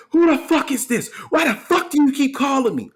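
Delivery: high, loud, mocking rage at a prank caller; mock furious lady. Angry woman yelling at the prank caller, high and loud mock rage. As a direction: play the cussing lady with a shrill laugh. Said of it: "So she's like".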